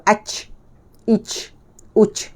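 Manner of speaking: "ach, ich, uch" with voiceless ch; old wrong jim. Shown as a demonstration. Teacher shows the old mistake, airy "ach, ich, uch". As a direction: end each of "ach, ich, uch" in air. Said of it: "dulu kita ada sebut".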